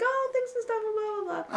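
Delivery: high-pitched mock chatter; imitated chatty hairdresser. Imitating the hairdresser's small talk, high mock chattiness.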